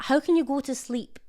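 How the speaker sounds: ordinary delivery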